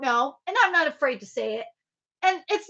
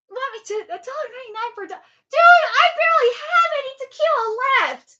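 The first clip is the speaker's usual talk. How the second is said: exasperated; 8 semitones higher, 4 dB louder, faster than usual. Real, not acted. Protesting a cheap tip.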